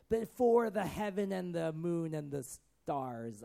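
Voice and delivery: slow, grand mock proclamation; drawn-out pompous vow; solemn oath. Shown as a demonstration. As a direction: swear theatrically by all the heavens. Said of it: "that's what an oath is".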